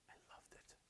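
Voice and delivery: soft unvoiced confession of a guilty pleasure; whispered aside. Real, not acted. Parent admitting to the audience she loved buying toys, whispered. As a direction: breathe it like a secret.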